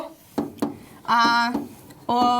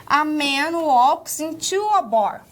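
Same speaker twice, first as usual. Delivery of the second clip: slow, careful English sentence; slow demo English; 2 semitones higher, slower than usual. Shown as a demonstration. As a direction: say it slowly, word by word. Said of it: "e você fala".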